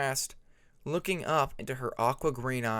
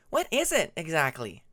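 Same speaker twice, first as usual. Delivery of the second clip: skeptical question; 4 semitones higher, 4 dB louder than usual. A narrator's reading. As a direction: raise a brow with your voice. Hyena presses.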